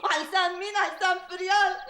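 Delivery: crying with wounded indignation; tearful complaint. Acted. Insulted woman lamenting to her comforter, tearful. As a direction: sob out the grievance. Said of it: "ومسحي دموعك بقى".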